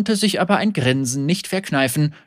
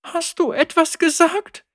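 very high, light question; girl asking Lucian; curious girl. A narrator's reading. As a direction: ask innocently. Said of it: "fragte das Mädchen".

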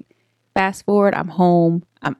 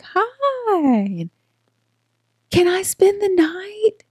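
put-on high, sweet kid asking a favor; little girl voice; 13 semitones higher, much slower than usual. Child begging her aunt for a sleepover, high little voice. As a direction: ask it like a hopeful five-year-old. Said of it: "And I'm like".

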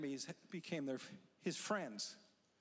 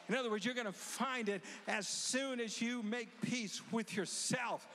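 higher, louder fervent preaching; preaching at full force; 4 semitones higher, 3 dB louder than usual. Acted. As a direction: drive it home to the crowd. Preaching climax.

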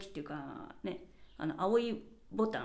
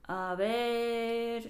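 アベール dragged out slowly to stall; アベール drawn long while thinking; stretched a ver; much slower than usual. Shown as a demonstration. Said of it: "めちゃめちゃ伸ばしちゃうんですよ".

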